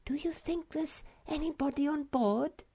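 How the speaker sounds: hushed, wary whisper; voiceless, high little whisper. A narrator's reading. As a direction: whisper it, afraid someone may hear. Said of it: "whispered the Snork Maiden".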